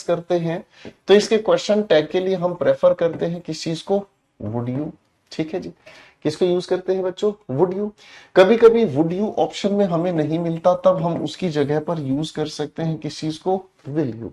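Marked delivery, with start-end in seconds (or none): 4.4-4.9 soft low tag. Shown as a demonstration. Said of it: "हमेशा नीची tone में". 13.9-14.3 soft low tag. Shown as a demonstration. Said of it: "हमेशा नीची tone में".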